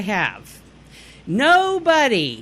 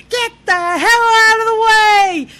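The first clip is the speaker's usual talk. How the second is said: mock road-rage shout; 11 semitones higher, 6 dB louder than usual. Driver retelling hanging out the window, mock enraged shout. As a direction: shriek it out the car window, hammed up.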